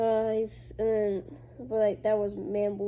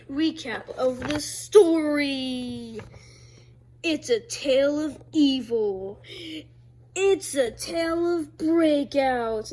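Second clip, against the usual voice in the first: slow, booming trailer narrator; movie trailer voice; 4 semitones higher, 5 dB louder, slower than usual. Acted. Kid presenting his comic like a movie trailer, booming and slow. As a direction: announce it like an epic film trailer. Dramatic trailer-style story intro.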